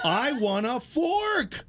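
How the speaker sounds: very high, drawn-out "fork" with the R lost; toddler mispronunciation. Retold or imitated. Nephew who can't say his R demands "fork", mispronounced. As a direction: imitate a toddler who drops the R in "fork", slowly. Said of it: "he can't pronounce fork".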